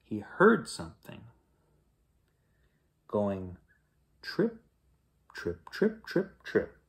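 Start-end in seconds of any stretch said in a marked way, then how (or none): 4.2-6.8 very soft little pattering words; hushed, high tiptoe sounds; tiny footsteps. A narrator's reading. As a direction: say each step lightly and quietly. Tiny footsteps heard behind him.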